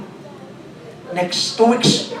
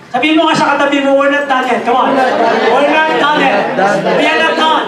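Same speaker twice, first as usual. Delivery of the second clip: higher, louder preacher's shout; full-force preaching; 6 semitones higher, 5 dB louder than usual. Acted. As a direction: drive it home to the congregation. Rallying the congregation.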